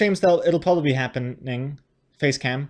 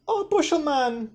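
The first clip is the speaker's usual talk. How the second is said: high call to the cat; 10 semitones higher than usual. Real, not acted. Calling the cat over.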